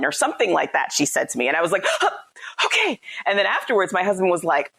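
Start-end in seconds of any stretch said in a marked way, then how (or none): 1.9-3.0 high, meek little okay after a gasp; mock-startled, high-pitched acceptance; startled compliance. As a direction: act out being startled into obeying. Startled compliance after being told off.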